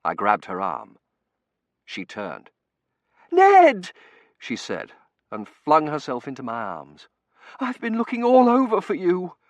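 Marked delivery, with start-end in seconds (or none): 3.3-3.9 high, loud call of recognition; overjoyed cry. A narrator's reading. She finds him amid din. 7.6-9.4 high, loud, relieved outburst; excited relief. A narrator's reading. Reunited, flings herself into arms.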